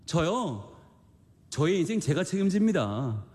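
high, insistent protest of a stubborn disciple; preacher acting out Peter's proud refusal, high-pitched; Peter refusing stubbornly. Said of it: "라고 할 때".

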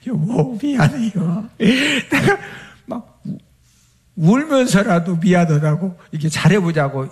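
a hint of tearful wife apologizing; pleading. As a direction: beg forgiveness in a lightly voiced tearful plea.

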